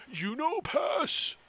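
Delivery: high, slow, loud simple ogre. Acted. Ogre asks Pinch if he knows the pass, slow puzzled ogre. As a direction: ask it like a puzzled giant. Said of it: "he's like".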